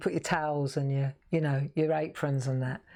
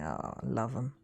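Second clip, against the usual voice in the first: soft, lower tender "Oh, I love 'em"; fond sigh; 5 semitones lower, 6 dB quieter than usual. Retold or imitated. Thinking fondly of the kittens, soft and tender. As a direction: melt over the kittens.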